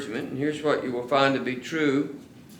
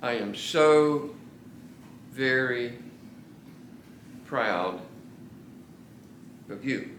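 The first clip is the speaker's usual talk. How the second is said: each word slow and weighty, trailing softer at the end; slow and deliberate; 4 dB quieter, slower than usual. Shown as a demonstration. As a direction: plant every word slowly in their minds. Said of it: "Hear the words".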